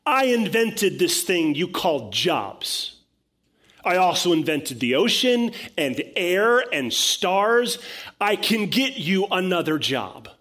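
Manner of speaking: loud, high, commanding rebuttal; booming divine authority, emphatic; God. Acted. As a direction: preach it as the Almighty putting a doubter straight. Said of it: "And his response is".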